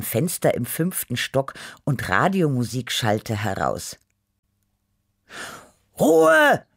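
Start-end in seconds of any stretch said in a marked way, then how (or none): 6.0-6.6 high, loud yell for silence; shouting out. A narrator's reading. Neighbour on second floor yells down at the music, shouting. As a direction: call it sharply across the courtyard. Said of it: "rief jemand aus dem zweiten Stock".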